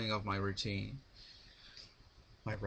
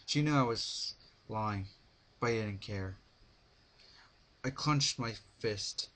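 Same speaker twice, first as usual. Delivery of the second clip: plain reading voice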